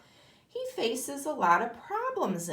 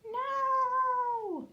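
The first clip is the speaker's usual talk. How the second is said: much higher frightened little no; scared cry. Acted. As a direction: yelp it out in fright. Spider lists what scares him.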